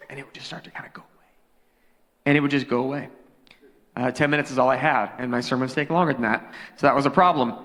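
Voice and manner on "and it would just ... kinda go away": fast, voiceless whisper of a lost voice; whispered demo of a voice gone. Shown as a demonstration. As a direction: let it all fade to breath. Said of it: "sound like this".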